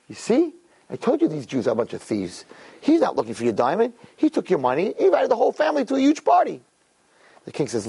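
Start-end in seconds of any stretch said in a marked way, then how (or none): 0.1-6.6 triumphant told-you-so, rushing; gloating accuser. Retold or imitated. Accuser at the window gloating to the king, fast and rising. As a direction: pitch swings up, fast at the end.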